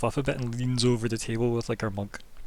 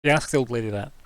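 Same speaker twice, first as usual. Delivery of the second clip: ordinary talk, nothing marked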